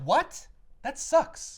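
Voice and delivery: high, loud, disbelieving protest; indignant outburst. Retold or imitated. Fan reacting to the broken-up partnership, loud indignation.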